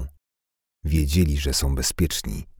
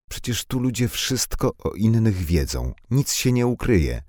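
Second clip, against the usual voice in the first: put-on lightly higher girl voice; 7 semitones higher than usual. Girl scoffing, pitched high.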